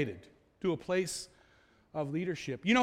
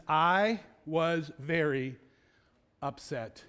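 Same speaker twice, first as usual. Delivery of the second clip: measured admission; 4 semitones lower, much slower than usual. Retold or imitated. Preacher quoting Nehemiah owning his emotion, slow measured admission. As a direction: state it slowly and deliberately.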